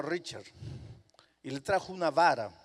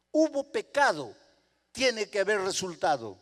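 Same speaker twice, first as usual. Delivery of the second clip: firm mother; 4 semitones higher, 5 dB louder than usual. Acted. Mother insists on punishment.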